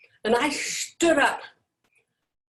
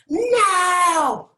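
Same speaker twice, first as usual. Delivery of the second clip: a sudden high crying yell of no; shouting no down the phone at her father; anguished shout; 9 semitones higher, 6 dB louder than usual. Retold or imitated. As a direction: cry it out into the phone, breaking. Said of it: "yelled into the phone".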